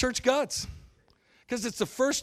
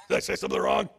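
loud, higher, laughing outrage; indignant Peter; 6 semitones higher, 9 dB louder than usual. Retold or imitated. Peter after Jesus calls him Satan, loud laughing indignation. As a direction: play a wounded Peter, incredulous. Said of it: "It's like".

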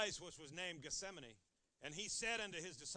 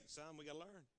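calm parent; 4 semitones lower, 8 dB quieter than usual. Acted. Parent answering child.

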